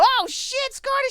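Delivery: very high, loud mock panic; alarmed cry. Player seeing teammate head for danger, panicked cry.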